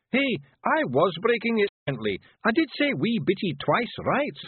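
high, rough little Scottish pictie, gently; a tiny Scots warrior breaking bad news; Feegle voice. Rob defends himself.

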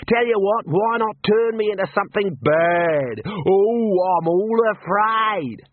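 the bandit jeering at the wizard's threat; sarcastic taunt. Acted. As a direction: pretend fear to mock him. Emu rider taunts.

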